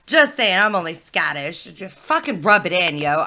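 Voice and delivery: ordinary talk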